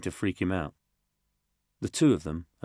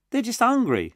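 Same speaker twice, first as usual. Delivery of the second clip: put-on high, loud tough little boy; a scrappy kid who feels a pang; little kid Josh; 7 dB louder than usual. Little Josh mourning the taken boy, high kid voice. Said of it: "said Josh".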